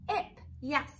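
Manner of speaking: ordinary delivery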